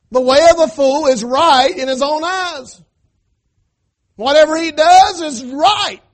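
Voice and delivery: loud, slow, high scripture preaching. Acted. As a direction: thunder the verse to the church. Preaching scripture at full force.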